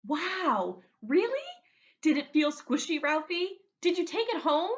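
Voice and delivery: put-on high, loud, eager kid voices; excited kids. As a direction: bubble over like thrilled classmates. Classmates excitedly question Ralph.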